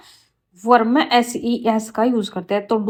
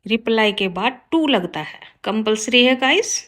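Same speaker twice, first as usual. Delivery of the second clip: ordinary talk, nothing marked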